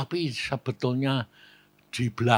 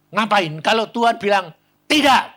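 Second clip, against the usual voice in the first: high, loud confrontation; scolding challenge; 8 semitones higher, 7 dB louder than usual. Retold or imitated. Pastor confronting a couple about God's answer, scolding. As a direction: challenge them, rising to a loud peak.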